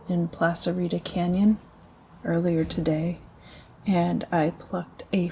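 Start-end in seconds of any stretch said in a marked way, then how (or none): none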